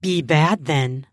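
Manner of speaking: mild low growl. Acted. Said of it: "I growled".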